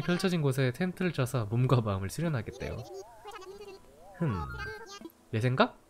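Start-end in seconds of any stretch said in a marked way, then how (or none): none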